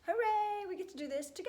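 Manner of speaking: high, loud hooray easing into eager chirp; excited cheer. Pretend. Teacher welcoming class to the new word list, excited cheer. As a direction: cheer to kick off the lesson.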